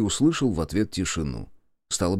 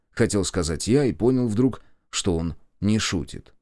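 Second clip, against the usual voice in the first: ordinary delivery, nothing marked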